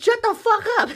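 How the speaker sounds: high-pitched mock yell, no real volume; comic high-voiced telling-off; retold yelling. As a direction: squeal it like an exasperated retort. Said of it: "he be yelling".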